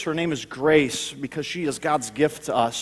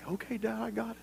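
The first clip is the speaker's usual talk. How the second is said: little son; 4 semitones higher, 15 dB quieter, faster than usual. Retold or imitated. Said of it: "looked at me like".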